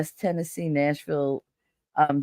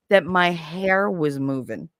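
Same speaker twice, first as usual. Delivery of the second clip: usual speaking voice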